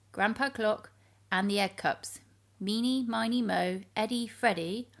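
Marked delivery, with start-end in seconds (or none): none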